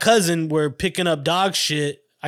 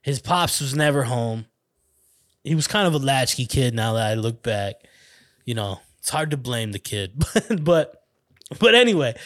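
ordinary delivery

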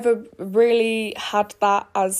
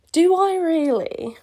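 cackled; 8 semitones higher, 4 dB louder, slower than usual. Acted. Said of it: "she cackled".

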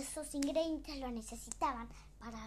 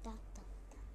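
breathy whisper, much softer; whispered suspense; 4 semitones lower, 8 dB quieter, faster than usual. A narrator's reading.

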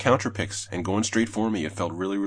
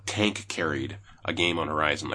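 ordinary delivery

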